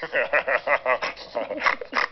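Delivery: a sudden snort mid-laugh. Acted. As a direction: giggle ending in a snort. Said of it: "Oh, I snorted".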